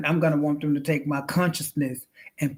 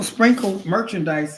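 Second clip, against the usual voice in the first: ordinary delivery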